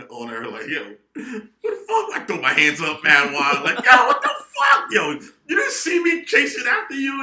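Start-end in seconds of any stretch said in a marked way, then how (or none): 0.7-2.1 high, drawn-out acted outrage; going ballistic. Retelling how he went off on mom, going ballistic. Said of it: "I went ballistic on you". 3.9-7.2 high-pitched, outraged full shout; going ballistic. Retold or imitated. Son yelling at mom who drove off, going ballistic. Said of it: "I went ballistic on you".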